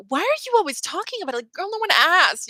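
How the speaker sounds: high, fast, mocking girl voice; mock sassy retort. Mimicking a critic telling the pop star off, high and fast. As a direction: toss it off in a high put-down. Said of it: "it's like".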